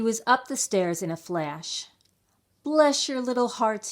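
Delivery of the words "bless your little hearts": high, louder desperate plea; crying out. A narrator's reading. Fox kneeling before the mice, crying out. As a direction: cry out gratefully on your knees. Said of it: "he cried, falling to his knees".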